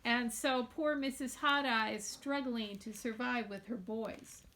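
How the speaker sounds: ordinary delivery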